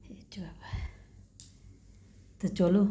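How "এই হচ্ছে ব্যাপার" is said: whisper. Real, not acted. Whispered aside.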